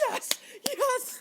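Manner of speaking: high-pitched squeal of triumph; mock crew cheer. Imitating the film crew after the good take, mock elated cheer. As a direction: cheer as if it finally worked.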